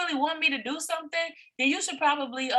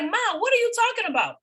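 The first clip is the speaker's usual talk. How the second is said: higher-pitched, mock-indignant kid to mother; imitated daughter; 7 semitones higher than usual. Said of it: "And she was like".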